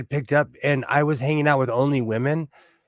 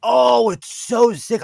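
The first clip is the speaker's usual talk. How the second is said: excited; 9 semitones higher, 5 dB louder, slower than usual. Real, not acted. Admiring a designer scarf.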